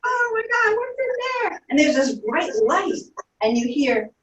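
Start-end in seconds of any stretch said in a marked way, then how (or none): 0.0-1.6 much higher, gasping with amazement; excited wonder. Pretend. Child imagines the doors opening, high excited gasp. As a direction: squeal at the open doors. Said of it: "And you're like".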